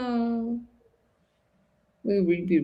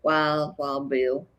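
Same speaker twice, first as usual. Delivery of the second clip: low, drawn-out fake Southern accent; 5 semitones lower, 4 dB quieter, much slower than usual. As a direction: put on a hammy Southern accent. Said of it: "in my fake Southern accent".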